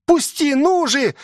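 put-on high-pitched cry of a child wriggling free; boy's high yell; struggling boy. A narrator's reading. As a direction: demand release in a high boy's voice. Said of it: "извивался он".

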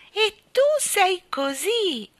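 high, loud, drawn-out exclamation; smiling cry. A narrator's reading. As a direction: cry it out slowly with a smile. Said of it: "gridò Nelly Rubina sorridendole". (grido sorridente, acuto e lento)

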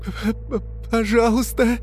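high, stuttering plea; squeaky, halting begging; terrified stammer. A narrator's reading. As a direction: stammer the first sound in panic.

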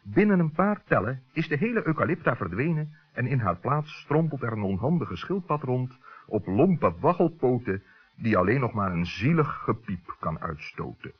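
usual speaking voice

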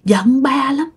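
put-on high, aggrieved little child complaining; a dead child's voice pouring out grievances. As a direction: speak as a hurt small child scolding her parents. Possessed woman speaks as dead child.

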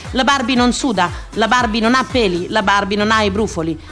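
ordinary delivery

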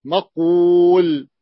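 مقول stretched slowly, each sound held; مقول drawn out slowly; drawn-out maqul. Shown as a demonstration. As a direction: say مقول very slowly to show the form. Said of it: "مقول أصلها مقول".

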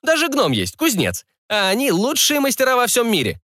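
high-pitched sales patter; coaxing barker. Acted. Barker talking up a dwarf smith to a client, coaxing high voice. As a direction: talk up the goods, pitched well up. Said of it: "продолжал увещевать толстяка зазывало".

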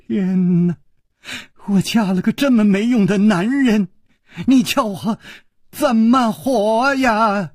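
high-pitched melodramatic sobbing lament; wronged wife wailing at heaven; loud wailing. A narrator's reading. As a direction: bawl it out, stretching the last words. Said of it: "忽然放声大哭".

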